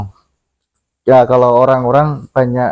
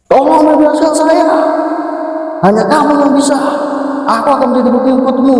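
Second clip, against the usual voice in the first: much higher, desperate shrieking plea; screaming spirit; 11 semitones higher than usual. Retold or imitated. Chained spirit screams to the narrator to free him, high and frantic. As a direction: shriek it fast as a chained demon. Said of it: "Terus dia teriak".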